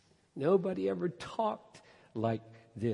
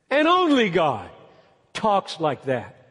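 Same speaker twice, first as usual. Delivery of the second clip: loud, high, impassioned sermon; emphatic pulpit proclamation; full-force preaching; 11 semitones higher, 9 dB louder than usual. Real, not acted. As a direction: cry it out to the congregation, stressing key words hard.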